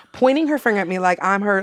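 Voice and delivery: ordinary talk, nothing marked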